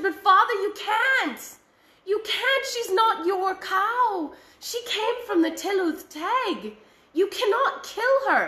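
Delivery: high, pleading son begging his father; desperate pleading. Acted. Son begging his father to spare the cow, high and pleading. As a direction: beg him not to kill the cow, voice up high.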